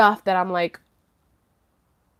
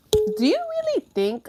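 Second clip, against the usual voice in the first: incredulous question; 12 semitones higher than usual. Retold or imitated.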